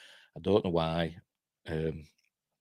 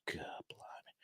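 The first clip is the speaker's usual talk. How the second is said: breathy whispered groan of exasperation; exasperated whisper at the article; whisper under breath; 22 dB quieter, slower than usual. Real, not acted. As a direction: mutter it to yourself, barely voiced.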